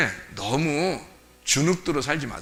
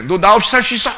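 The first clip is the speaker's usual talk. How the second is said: loud, higher, forceful challenge; confident; 4 semitones higher, 7 dB louder than usual. Acted. Jesus boldly challenging us, loud and confident. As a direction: throw the question down boldly. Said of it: "예수님이 당당하지, 우리한테".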